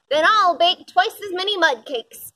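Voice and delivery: a boastful snort. A narrator's reading. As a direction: huff it out, trying to outdo the others. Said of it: "snorted the warthog".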